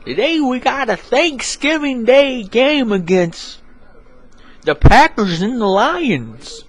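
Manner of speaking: a high, slow sportscaster; high slow announcer. Acted. Announcer opening the Thanksgiving broadcast, high and slow. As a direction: announce the matchup high and drawn out.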